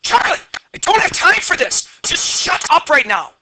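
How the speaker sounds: high, loud, exasperated outburst; pissed-off dad snapping. Retold or imitated. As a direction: lose your temper at your kid, voice jumping up. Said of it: "getting more and more pissed".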